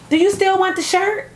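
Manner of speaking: high-pitched offering question; imitated stranger. Acts out the woman's question.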